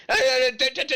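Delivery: high, loud staccato "da-da-da" noises; imitated slicing noises. Game master acting out the killing blow, high imitated noises. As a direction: voice a rapid attack in high nonsense syllables. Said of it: "It, it goes".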